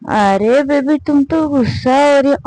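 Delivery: put-on can barely get the words out, drunk; drunk slurring. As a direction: drunk girlfriend slurring at her partner. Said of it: "ये properly बोल भी नहीं पा रही थी".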